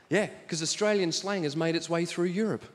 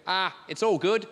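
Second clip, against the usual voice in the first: lazy relaxed Aussie reply; waitress in Aussie slang; 3 semitones lower than usual. Retold or imitated. Dutch waitress answering in a relaxed Aussie accent. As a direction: shrug it off, easygoing. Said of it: "she just went".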